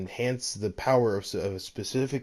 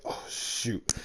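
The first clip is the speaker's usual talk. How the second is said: embarrassed whisper; 6 dB quieter than usual. Acted. Deku caught off guard, embarrassed.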